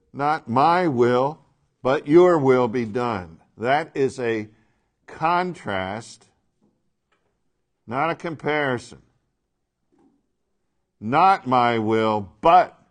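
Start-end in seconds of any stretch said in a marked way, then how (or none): 0.1-3.4 MY and YOUR hit loud and drawn out; slow, loud stress setting "my" against "your"; emphatic contrast. Acted. Teacher showing two opposing wills, slow and emphatic. 11.0-12.8 NOT drawn out, BUT punched loud; slow and loud, then hammer the word "but"; emphatic contrast. Acted. Teacher proving it is a contrast, loud and emphatic.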